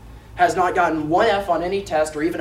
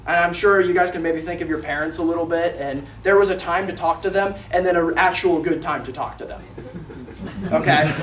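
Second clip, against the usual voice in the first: ordinary delivery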